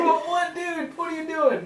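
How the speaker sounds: high, laughing what dude; mock surprised dude. Joking about the name Snoke, high mock disbelief. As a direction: squeak it out in disbelief. Voicing a friend's reaction.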